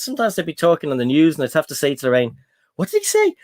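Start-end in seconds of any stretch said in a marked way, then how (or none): 2.8-3.4 high-pitched, puzzled asking; baffled question. Retold or imitated. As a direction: ask it high, totally lost. Baffled question to Lorraine about news.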